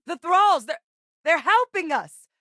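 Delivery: high, loud, amazed exclamations; astonished calling out. Acted. Asking Percival about thralls helping us, astonished shout. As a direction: call out in disbelief, rising sharply on the question.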